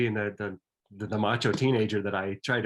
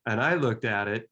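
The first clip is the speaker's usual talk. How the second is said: normal talking voice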